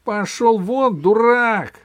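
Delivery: high, loud shout of dismissal; angry shout. A narrator's reading. Captain shouts at the seal spoiling his view, furious yell. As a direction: yell it high and hard to chase someone off. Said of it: "крикнул я".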